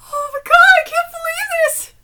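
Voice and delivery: high, excited squeal; overwhelmed disbelief. Real, not acted. Fan interrupting the reading, overwhelmed disbelief.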